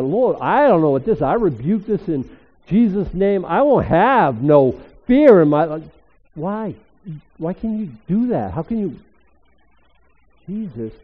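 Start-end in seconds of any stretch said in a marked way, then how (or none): 0.0-5.8 loud, fearless rebuke of the fear; bold prayer. Retold or imitated. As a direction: pray it out like a command to the dark. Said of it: "I said".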